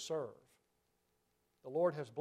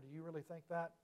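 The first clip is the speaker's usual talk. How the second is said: normal talking voice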